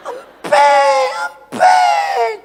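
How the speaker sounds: very high screamed wail; imitated toddler scream. Toddler crying to papa for his pacifier, imitated screaming wail. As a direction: shriek like a crying toddler demanding. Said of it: "dia teriak".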